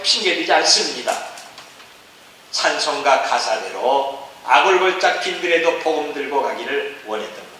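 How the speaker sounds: ordinary talk, nothing marked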